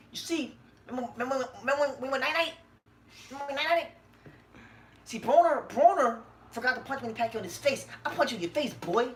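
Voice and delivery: high, lisping boxer taunt; Keith Thurman. Acted. Host plays boxer taunting a rival, high lisping impression. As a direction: pitch it up and lisp the trash talk. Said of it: "he has a list for Keith Thurman".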